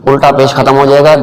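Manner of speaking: ordinary talk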